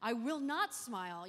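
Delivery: loud, indignant retort, slowing on the refusal; retold angry retort. Snapping back at a stranger who says smile, loudly indignant. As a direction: fire it back at him, offended and loud. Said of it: "I was so mad".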